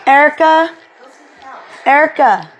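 ordinary delivery

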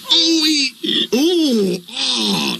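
high, strained groaning trucks. A narrator's reading. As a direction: groan like rusty old trucks being dragged. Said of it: "the trucks groaned".

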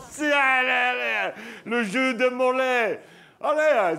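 rally the guests like a party host; party excitement. Retold or imitated. Hyped remembering party game.

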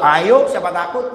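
loud, slow, put-on shouted dare; mock shouted dare. Two men in the mosque shouting a mock dare. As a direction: shout back a challenge.

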